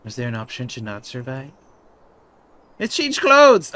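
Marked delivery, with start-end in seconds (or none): 2.8-3.7 high, loud gleeful cry; loud excited outburst; thrilled. Real, not acted. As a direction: squeal with delight. Thrilled at character's outfit.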